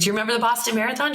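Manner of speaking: put-on sing-song reassurance for a child; talking to a small child. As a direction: explain it sweetly to a little girl. Asking child a question.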